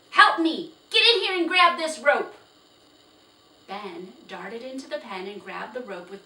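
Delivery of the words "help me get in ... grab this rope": high, very loud, fast command; barked order. Acted. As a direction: bark the order at the boy. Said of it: "He glared at Ben and barked".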